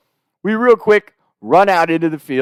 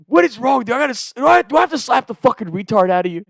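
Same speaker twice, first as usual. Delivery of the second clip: high-pitched mock scolding; imitating furious brother; 8 semitones higher, faster than usual. Brother berates him for trampling the trail, furious. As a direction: act out a brother at his wits' end.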